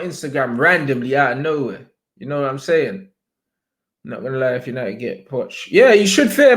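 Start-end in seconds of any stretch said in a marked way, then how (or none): 5.7-6.6 higher, louder jab at rivals; taunting warning. Real, not acted. Streamer to rival fans, loud taunting warning. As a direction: warn them with a grin.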